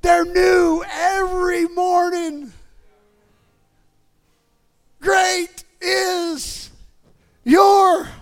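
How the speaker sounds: high, impassioned proclamation; full-force preaching. Real, not acted. Preacher on mercy for man in the pit, high impassioned preaching. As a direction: cry the verses out to the church.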